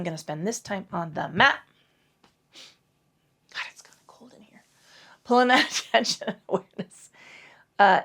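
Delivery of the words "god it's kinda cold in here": fast unvoiced whisper; quick whispered aside about the cold room. Real, not acted. Aside during the class.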